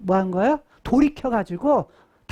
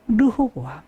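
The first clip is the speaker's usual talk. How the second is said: ordinary delivery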